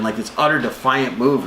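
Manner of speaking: ordinary delivery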